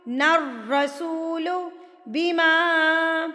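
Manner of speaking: slow, stretched tajweed reading; slow recitation. Shown as a demonstration. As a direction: draw each syllable out. Recitation demo. (آہستہ تجوید کے ساتھ)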